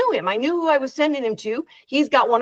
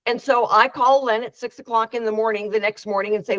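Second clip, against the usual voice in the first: usual speaking voice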